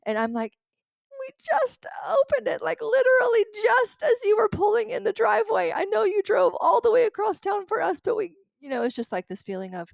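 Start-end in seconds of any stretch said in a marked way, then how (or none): 1.2-8.3 high, loud, tearful apology; crying apology. Retold or imitated. Crying to Mister Don as he pulls in, a high tearful apology. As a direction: blurt it out through tears, sorry and overwhelmed. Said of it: "I'm crying".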